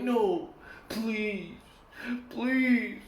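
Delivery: high, soft, drawn-out begging; mock pleading. Kids begging dad before a whipping, mock pleading. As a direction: imitate a child begging not to be hit. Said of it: "he would get more angry when we were like".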